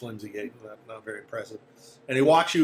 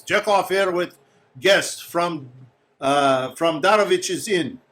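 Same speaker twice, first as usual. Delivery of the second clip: raised, higher calling voice; announcing at the door; 5 semitones higher, 6 dB louder, slower than usual. Acted. Guide to those inside, calling out an announcement. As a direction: project it through a closed door.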